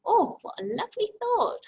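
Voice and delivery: teacher delighted by the sentence. Real, not acted. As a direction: sound charmed by the idea.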